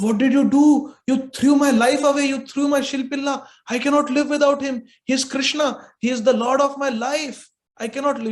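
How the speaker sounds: high, loud, desperate pleading; anguished cry. Acted. Bride wailing at her husband, loud and high. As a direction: wail at husband as if your life is gone. Bride crying over thrown stone.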